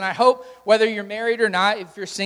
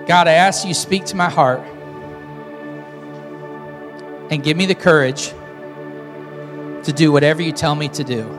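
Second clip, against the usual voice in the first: guided prayer; 4 semitones lower than usual. Acted. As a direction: lead them line by line. Leading repeat-after-me prayer.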